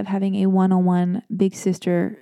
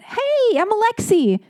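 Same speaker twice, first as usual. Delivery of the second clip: mimicking her own chirpy high hello; bubbly high greeting; 14 semitones higher than usual. Host imitating herself chirpily greeting the barista. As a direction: answer in a much higher, bubbly voice. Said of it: "And I went".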